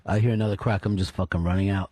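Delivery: lightly put-on low voice; imitating a coworker. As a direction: retell his line down low. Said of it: "He goes like this".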